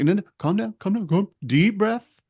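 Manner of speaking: high, hammed-up calming voice; imitating himself soothing them; mock soothing. Repairman calming panicked kid, mock soothing. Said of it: "I'm like".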